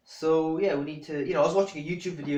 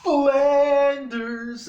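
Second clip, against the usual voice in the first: long drawn-out, high heckling shout; loud, high mock jeer; Homer Simpson; 11 semitones higher, 7 dB louder, much slower than usual. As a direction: bellow it from the sidelines, stretching the name out.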